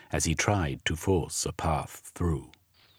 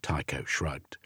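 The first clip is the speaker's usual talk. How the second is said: ordinary delivery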